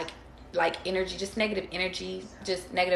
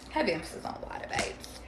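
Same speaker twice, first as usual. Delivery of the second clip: softer aside, dropping away; quiet repeat; 8 dB quieter than usual. Pretend. Softer repeat of the same remark.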